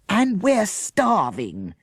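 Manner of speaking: slow, drawn-out accusing whine. A narrator's reading. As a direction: lay the blame on them. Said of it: "said Jessica accusingly".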